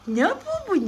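high-pitched cooing question; playful high pet voice; pet talk. Real, not acted.

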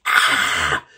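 loud, low "ah" yell; loud yell pitched well down; imitated scream. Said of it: "Actually, it wasn't like".

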